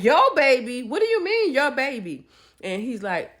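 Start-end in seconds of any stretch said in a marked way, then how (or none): 0.0-2.3 high, shocked voice, words rising to a squeak; the wife reeling at hearing about his baby, high shocked disbelief. Retold or imitated. Wife confronting the husband about his baby, high and shocked.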